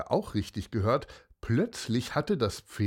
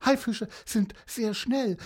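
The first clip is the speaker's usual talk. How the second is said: plain reading voice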